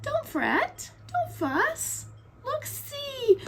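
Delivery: tiny high-pitched mouse voice; little storybook mouse reassuring a friend; squeaky mouse. Acted. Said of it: "Mouse squeaks".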